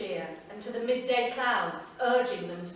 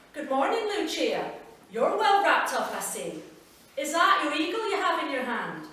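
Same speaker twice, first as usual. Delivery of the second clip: put-on higher, louder, hearty greeting from an old Scots man; Willie, cheery old neighbour; 6 semitones higher, 7 dB louder than usual. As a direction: call out warmly across the doorway as the old neighbour.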